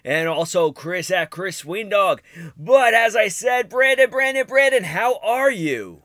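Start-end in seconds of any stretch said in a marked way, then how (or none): 2.6-6.0 loud, high, hyped call; excited shout. Real, not acted. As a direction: call him out like a hype man. Hyped calling out to co-host.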